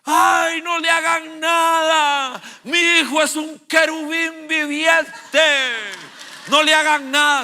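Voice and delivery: high, loud, slow pleading shriek; mother shouting at the police to spare her son; frantic mother. Retold or imitated. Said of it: "pegando gritos".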